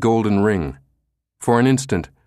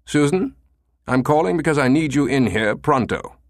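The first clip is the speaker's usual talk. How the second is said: stern commander; 6 semitones higher than usual. Acted. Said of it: "voice turned suddenly stern".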